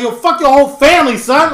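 loud, high retold outburst; mock angry yell. Retelling cursing at a stranger's kid, mock loud fury. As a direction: snap at the kid with fake fury.

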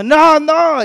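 high-pitched mock cry of protest; imitated child's yell. Parent retelling the son's protest at an injection, imitated high yell. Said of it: "me estaba gritando".

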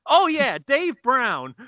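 high, hammed-up burst of false recall; oblivious stage host. Host getting the comic's name wrong, hammed-up announcing. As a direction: blurt it high and loud as the mock host.

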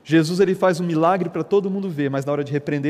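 ordinary delivery

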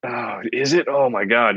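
ordinary talk, nothing marked

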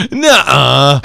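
sudden loud, high blurted "nuh-uh"; blurted refusal. Retold or imitated. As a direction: let the honest no slip out loud. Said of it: "his natural reaction slipped through".

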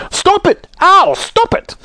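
very high pained cries; shouted. A narrator's reading. Saucepan man being knocked about above, shouting. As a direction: yelp it out as if under attack. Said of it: "shouted the saucepan man above them".

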